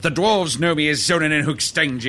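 grand wizard proclaiming his titles; pompous wizard. Acted. Wizard introducing himself by his many names, pompous and grand. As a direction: roll out each title with ceremony.

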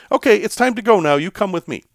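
mock French-accented order; bossy French accent. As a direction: imitate a pushy French woman rounding you up. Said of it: "a little bit of a French accent".

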